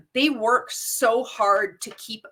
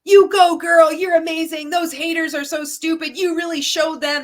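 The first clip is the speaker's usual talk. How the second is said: high, loud, over-the-top fan praise; mock gushing cheerleader; 6 semitones higher, 7 dB louder than usual. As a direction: gush like a cheering upline friend. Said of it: "all we see is".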